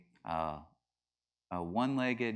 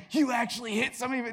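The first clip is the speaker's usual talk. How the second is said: high, loud, quick imitation of excited kids; 14 semitones higher, 5 dB louder, faster than usual. School kids reacting to the punching story, imitated excitement. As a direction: blurt it out high and fast, mock excitement. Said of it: "like, all excited".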